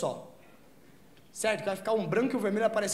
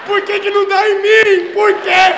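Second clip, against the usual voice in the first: much louder, higher frustrated shouting; mock furious rant; 10 semitones higher, 15 dB louder, slower than usual. [name] ranting at her about the drug, furious shout. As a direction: yell in outrage that the high never comes. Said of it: "E eu puto".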